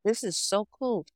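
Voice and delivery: high-pitched retold delight; acted excitement. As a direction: replay an impressed first reaction, high. Acts out own reaction to station.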